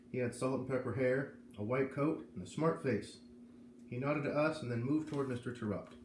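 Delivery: usual speaking voice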